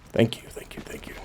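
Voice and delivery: whispered thanks of relief; real whispered relief after escaping; relieved whisper. Real, not acted. Relieved after a close call.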